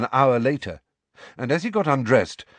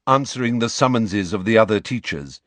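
plain reading voice

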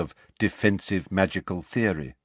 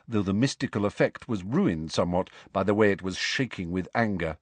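ordinary delivery

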